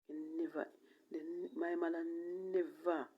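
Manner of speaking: ordinary delivery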